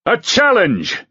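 ringing knight's shout. A narrator's reading. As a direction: hurl the challenge at the hall. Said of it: "he shouted".

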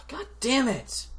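drawn-out high curse of frustration; frustrated curse. Real, not acted.

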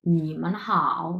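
নিমান হাও spoken slowly, tones drawn out; teacher models নিমান হাও; slow tone demo. Shown as a demonstration. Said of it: "বি কি বলতেছে?".

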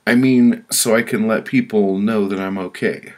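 loud, high, anxious explanation; hasty backpedalling. Acted. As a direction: scramble to explain yourself. Victim hastily explains himself to judge.

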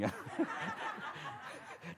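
soft unvoiced laugh, rising; breathy laugh. Real, not acted. Preacher laughs at his mom's badge, quiet laugh. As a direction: let a quiet laugh slip out.

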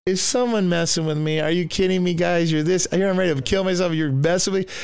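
high, incredulous questioning; acted disbelief. Narrator to his friends on hearing the radio, acting incredulous. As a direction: protest as if you're being pranked.

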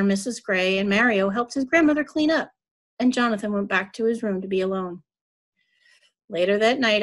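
ordinary delivery

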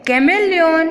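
chameleon high and deliberate; teacher's high model chameleon; English pronunciation demo. Shown as a demonstration. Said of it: "અંગ્રેજી ઉચ્ચાર થશે".

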